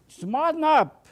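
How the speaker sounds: loud, high, slow mock holler from the viewer; imagined viewer hollering at the painter; pretend holler. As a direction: bark it out like a fed-up audience. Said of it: "I wish you could holler".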